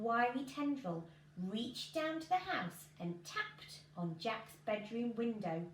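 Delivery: plain reading voice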